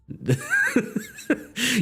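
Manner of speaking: squealing laugh; high burst of laughter. Real, not acted. Laughing at the cowboy walking off.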